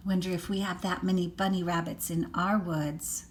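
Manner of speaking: usual speaking voice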